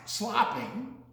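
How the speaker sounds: ordinary delivery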